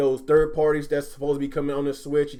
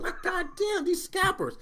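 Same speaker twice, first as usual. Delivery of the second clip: exasperated rant; 11 semitones higher, 4 dB louder than usual. Real, not acted. Ranting at scalpers and friend.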